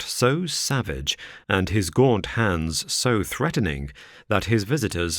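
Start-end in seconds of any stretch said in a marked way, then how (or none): none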